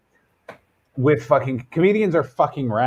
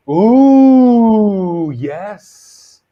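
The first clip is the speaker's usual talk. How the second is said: high, loud, drawn-out ooh, then a slow yes; hammed-up gasp of delight; mock surprised ooh; 8 semitones higher, 8 dB louder, much slower than usual. Joking about his therapist asking about his habits, in a mock high ooh.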